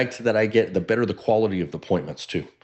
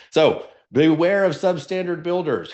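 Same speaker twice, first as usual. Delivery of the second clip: mock warning; 8 semitones higher than usual. Shown as a demonstration. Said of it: "mock warning to the room".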